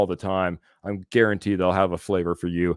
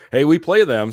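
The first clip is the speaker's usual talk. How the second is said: laughing; 6 semitones higher, 6 dB louder than usual. Real, not acted. Laughing at opponent's bad record.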